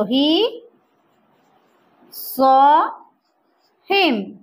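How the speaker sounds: I ... live ... near ... here, each word set apart; slow word-by-word drill. Shown as a demonstration. Teacher drilling a new phrase, slow word by word. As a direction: separate every word with a long pause. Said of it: "teacher drills word by word".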